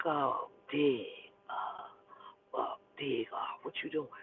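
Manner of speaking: soft low drawl of a sly father; a hint of a shifty old man; imitating daddy. Daddy calling her on the phone, sly low voice.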